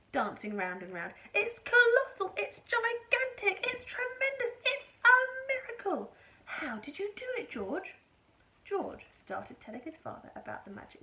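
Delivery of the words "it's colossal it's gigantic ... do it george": excited shout. A narrator's reading. Mister Cranky dancing round and shouting at the hen. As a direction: shout it with delight.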